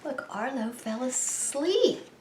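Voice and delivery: plain reading voice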